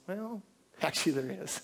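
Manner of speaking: quiet amused reply with a soft laugh; Jesus chuckling. Retold or imitated. Jesus answering the repentant sinner, softly laughing. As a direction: lightly laugh it off, kindly. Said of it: "Jesus says".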